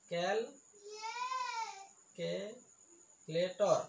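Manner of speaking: "Calculator" in four very slow beats; syllable by syllable. Shown as a demonstration. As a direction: pause between the syllables of "calculator". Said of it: "চাৰিটা চিলেবুল আছে". ("Calculator" চাৰিটা ভাগত ভাঙি লাহে লাহে)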